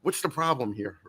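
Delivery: quietly calm question to the desk. Retold or imitated. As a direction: imitate a cool, unbothered official taking charge. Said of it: "and very calmly he goes".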